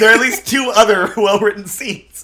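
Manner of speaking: amused, laughing objection. Real, not acted. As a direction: protest loudly while cracking up. Joking correction with laughter.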